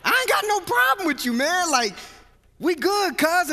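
hammed-up "we good, cuz"; emphatic reassurance. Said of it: "And I'm like".

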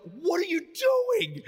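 high, drawn-out mock wail; exasperated outcry. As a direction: stretch it into a pained, high-pitched protest. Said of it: "we're just dying".

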